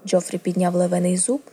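plain reading voice

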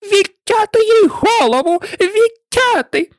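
high-pitched furious shriek; shrill royal tantrum, very high; enraged screaming queen. A narrator's reading. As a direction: scream the order, drawing out the last word. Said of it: "зарепетувала".

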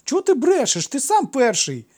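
very high, loud retort; squealing boyish indignation; indignant child. A narrator's reading. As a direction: fling the accusation straight back. Boy denies starting the fight.

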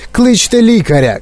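high, urgent call; crying out. A narrator's reading. Father demands a doctor, crying out. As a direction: call for help in alarm. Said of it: "крикнув пан Борегар".

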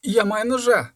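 high, loud frightened shout. A narrator's reading. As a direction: shout the warning in panic. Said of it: "крикнув Яр".

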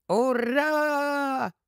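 tiny creature's high, drawn-out cheer; high piping hurrah; piercing squeak. A narrator's reading. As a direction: squeal a long battle cheer. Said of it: "пропищав раптом з-під ніг доктора пронизливий".

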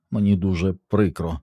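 plain reading voice

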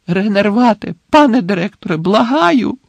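high, urgent cry rising in pitch; desperate pleading. A narrator's reading. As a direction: beg him to wake, nearly shouting. Repeated spell, begging the headmaster to wake.

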